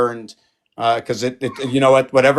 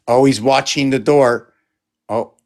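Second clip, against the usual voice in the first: ordinary talk, nothing marked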